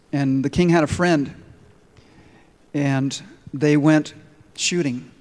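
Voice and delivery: ordinary talk, nothing marked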